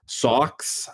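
Sawx with a broad Boston vowel; Boston accent. Shown as a demonstration. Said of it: "practicing my Boston accent".

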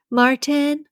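put-on high-pitched old lady calling; high searching call for a husband. A narrator's reading. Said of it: "a voice calls out".

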